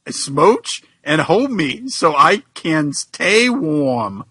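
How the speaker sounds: drawn-out pleading; slow mock plea. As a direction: linger on each word, mock begging. Voicing Tails pleading.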